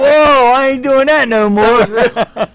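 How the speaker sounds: high, loud, drawn-out mock alarm; comic startled retelling, slowing; startled refusal. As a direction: recoil with a long loud whoa. Acting out startled reaction.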